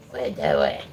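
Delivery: "What you doing?" dropped very low; low pet voice. Real, not acted. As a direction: growl it down low at the dog. Teasing the dog.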